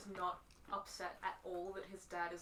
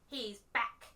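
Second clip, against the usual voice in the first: slow, loud, hammed-up announcement; mock solemn proclamation; bad dramatic acting; 3 semitones higher, 18 dB louder, slower than usual. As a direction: overact the line like a wooden actor. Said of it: "the worst bit of acting".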